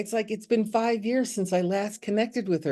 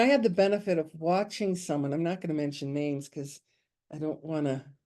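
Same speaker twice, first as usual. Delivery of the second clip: normal talking voice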